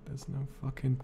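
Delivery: normal talking voice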